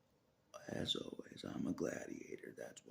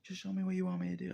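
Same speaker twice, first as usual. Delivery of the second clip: high, loud exasperated plea; 13 semitones higher, 7 dB louder, faster than usual. Real, not acted. To God, begging to be shown what to do, high and loud. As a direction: throw the demand up at God, pitched high.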